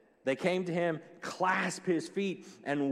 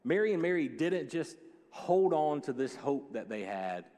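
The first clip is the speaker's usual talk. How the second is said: ordinary delivery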